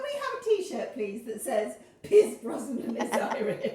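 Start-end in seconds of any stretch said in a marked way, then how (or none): 2.0-3.7 slow, loud, through laughter; real laughter; laughing slogan. Real, not acted. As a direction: announce the t-shirt slogan giggling.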